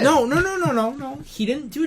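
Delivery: high, rapid-fire protest; emphatic objection. Real, not acted. Co-host correcting his friend about the impression, emphatic objection.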